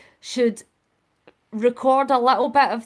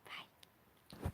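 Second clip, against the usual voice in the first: tiny voiceless whisper; whispered goodbye; 26 dB quieter than usual. Real, not acted. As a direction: whisper it as you sign off.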